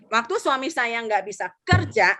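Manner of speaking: ordinary delivery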